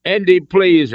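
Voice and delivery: high, loud desperate plea; imploring. A narrator's reading. Hostage begs Indy at gunpoint, desperate imploring. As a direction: implore him in panic. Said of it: "Elsa implored".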